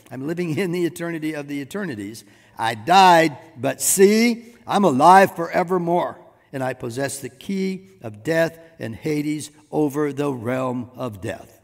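ringing pulpit reading, hard on key words; forceful scripture reading. Acted. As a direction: proclaim the verses with building force.

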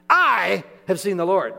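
loud, high proclamation of the news; joyful shout. Shown as a demonstration. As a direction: burst out with it, thrilled. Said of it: "It's like".

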